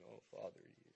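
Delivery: a hint of a solemn churchy prayer; lightly hammed-up formal prayer, quiet; mock priestly prayer voice. Shown as a demonstration. Preacher mocking stiff prayer to a distant Father, quietly hammed-up. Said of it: "I'm not sure how to do it".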